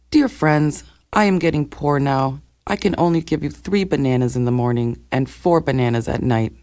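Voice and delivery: ordinary delivery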